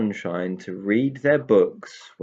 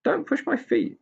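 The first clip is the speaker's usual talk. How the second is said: much higher, peevish pixie; cross pixie voice. Acted. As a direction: complain like an irritated little pixie. Pixie complaining about his feet.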